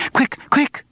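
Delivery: high, urgent cry; panicked cry. A narrator's reading. Studio guide hurrying her group away, panicked cry. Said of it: "she cried".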